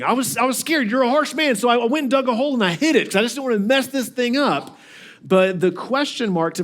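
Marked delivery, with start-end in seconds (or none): 0.0-4.8 higher, fast pleading excuse; whiny excuse. Retold or imitated. Lazy servant excusing himself to his master, whiny. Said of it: "That's what he's saying".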